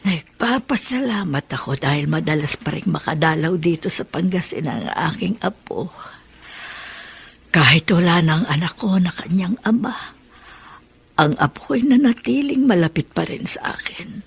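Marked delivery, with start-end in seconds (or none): 2.7-14.2 whispered private thoughts; hushed thinking aloud; inner thought whisper. Acted. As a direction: whisper it as a grandmother's prayer.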